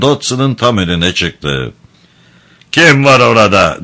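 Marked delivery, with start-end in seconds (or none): 2.7-3.8 louder, higher called-out challenge. A narrator's reading. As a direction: call out sharply into the dark. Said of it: "yüksek bir ses tonuyla". (yüksek bir ses tonuyla)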